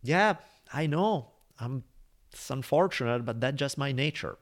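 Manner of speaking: scorpion shrugging to the dying frog, lightly voiced. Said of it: "the scorpion is like".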